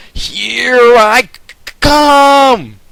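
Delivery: high, loud stretched yell; battle cry. A narrator's reading. Jet diving at full throttle, high stretched yell. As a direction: hold it out like a charge.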